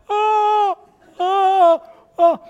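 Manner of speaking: panicked. Retold or imitated. Young pastor panicking.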